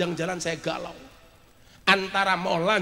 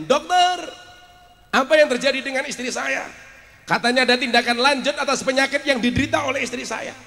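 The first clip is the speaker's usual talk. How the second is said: higher, urgent demand for answers; urgent phone questioning; 7 semitones higher, 6 dB louder than usual. Retold or imitated. Husband phoning the doctor about his wife, urgent questioning. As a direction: press the doctor for an explanation. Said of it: "saya telepon".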